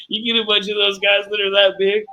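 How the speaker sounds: high-pitched, talking through laughter; barely holding in the laugh, voice pushed up high; cracking up. Real, not acted. Streamer joking to co-host about huge island players, cracking up.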